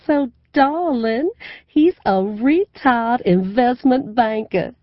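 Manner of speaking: high, loud, slow honeyed Southern drawl; gushing southern belle. A narrator's reading. Mother gushing on the phone about her new man, in a slow, high Southern drawl. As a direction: stretch every vowel like Scarlett O'Hara. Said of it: "Scarlett O'Hara thing going so hard".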